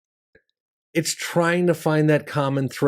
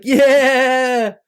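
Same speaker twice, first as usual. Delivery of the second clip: high loud yell of agreement; crowd shout; 11 semitones higher, 5 dB louder than usual. Retold or imitated. Crowd answers the comic's joke with a loud high yell. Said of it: "They're like".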